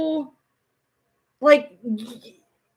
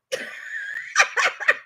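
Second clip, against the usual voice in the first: breathless laugh. Real, not acted.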